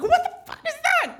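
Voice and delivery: high, loud burst of disbelief; a yelped what the fuck; shocked outburst. Retold or imitated. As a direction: recoil at the sight of it. Shocked at the fake banana.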